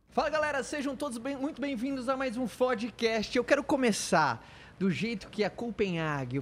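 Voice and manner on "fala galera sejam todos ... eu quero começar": host intro. Acted. Podcast opening.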